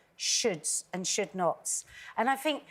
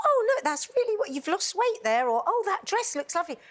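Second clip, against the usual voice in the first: admiring friend; 8 semitones higher than usual. Acted. Voices admiring mates' compliments.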